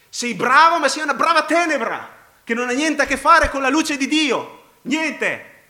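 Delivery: loud, high pulpit cry; full-force preaching. Real, not acted. Preacher telling the good they are still darkness, full-force preaching. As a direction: thunder it at the congregation.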